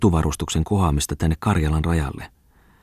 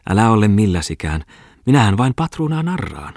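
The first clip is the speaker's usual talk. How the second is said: raised, lively woman's voice, bragging; boastful hostess; 5 semitones higher, 4 dB louder than usual. A narrator's reading. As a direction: brag about the deal like a sly hostess. Aina Kustava laughs off the patron. (Aina Kustava kehuu kauppaa kohotetulla äänellä)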